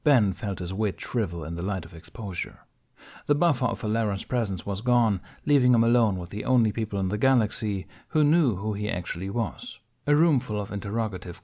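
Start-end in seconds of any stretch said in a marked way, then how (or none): none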